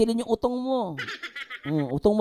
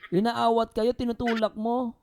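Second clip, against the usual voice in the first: ordinary delivery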